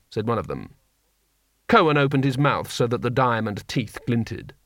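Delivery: plain reading voice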